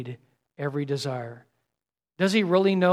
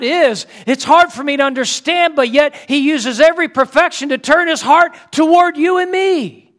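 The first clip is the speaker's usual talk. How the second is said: louder, higher, driving preacher voice; full-force preaching; 6 semitones higher, 6 dB louder than usual. Acted. Preacher to congregation, rising fervent preaching. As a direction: pour it out from the pulpit.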